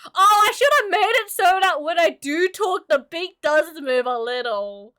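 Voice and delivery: loud, high sudden idea; excited realisation. Real, not acted. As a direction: burst out with a new idea.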